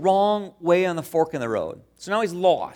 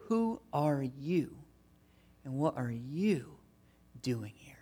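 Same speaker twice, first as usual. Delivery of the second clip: slow, softer, ending in a whisper; fading whisper; 4 semitones lower, 10 dB quieter, slower than usual. Acted. Preacher lets the question fade out for the congregation, whispered. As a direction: let the question die away into a whisper.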